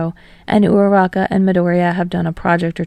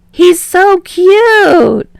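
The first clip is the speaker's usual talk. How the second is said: very high, loud, slow squeal; drawn-out delighted shriek; 10 semitones higher, 8 dB louder, much slower than usual. Acted. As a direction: squeal over something adorable. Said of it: "Kaminari shrieks".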